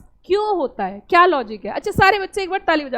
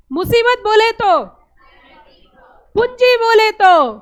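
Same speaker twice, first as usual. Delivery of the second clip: loud slow cue; 3 semitones higher, 5 dB louder, slower than usual. Shown as a demonstration. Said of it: "एक सुर में बोलना".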